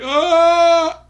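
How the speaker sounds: high, loud imitation scream; mock scream. Retelling the leads facing the bear, high mock scream. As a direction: imitate movie victims screaming. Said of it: "they're screaming like".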